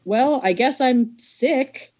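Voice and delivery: slow, high, sly excuse; mock sick. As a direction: fake the sickness, drawn out. Faking sick to the boss.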